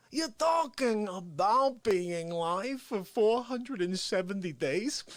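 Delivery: high-pitched manic Fauci persona, mock outrage; Fauci character voice. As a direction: play the unhinged rival host. Streamer voicing Fauci character.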